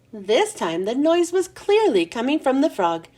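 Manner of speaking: plain reading voice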